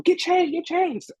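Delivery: high urgent command; urgent crew. Retold or imitated. Crew rushing him to dress, high urgent order. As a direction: hurry him along.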